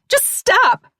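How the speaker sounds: piercing high scream of a command; terrified shriek; cried out. Acted. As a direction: scream at him to stop. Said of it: "Mare cried".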